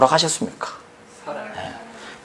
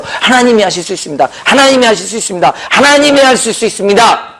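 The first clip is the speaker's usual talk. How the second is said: from usual pitch up to a high, emphatic declaration; building proclamation; 4 semitones higher than usual. Real, not acted. Preacher declaring to congregation that only God heals, building in conviction. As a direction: raise each repetition a step higher.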